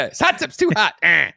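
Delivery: high, whooping hot-tub impression; Eddie Murphy. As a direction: yelp it out in a high voice. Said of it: "That's Eddie Murphy in it".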